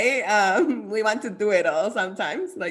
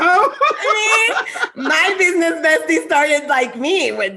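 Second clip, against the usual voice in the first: real laugh breaking into a loud high protest; 6 semitones higher, 6 dB louder, slower than usual. Real, not acted. Laughing protest about zero start.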